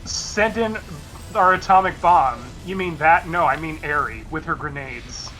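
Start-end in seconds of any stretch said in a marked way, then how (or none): none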